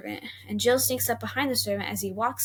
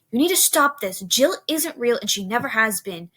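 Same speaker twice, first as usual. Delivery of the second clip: louder, firm father; stern scolding; 6 dB louder than usual. Acted. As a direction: lay down the law.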